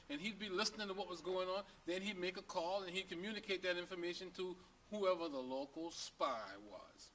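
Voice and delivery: normal talking voice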